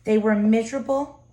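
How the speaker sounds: ordinary delivery, nothing marked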